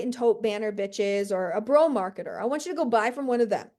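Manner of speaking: ordinary talk, nothing marked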